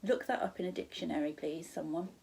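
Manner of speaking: plain reading voice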